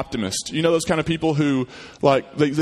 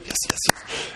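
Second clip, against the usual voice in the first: whispered giddy yes, yes, yes; excited whisper; 6 dB quieter than usual. Acted. Optimistic kid delighting in nothing, whispered. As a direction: cheer it under your breath.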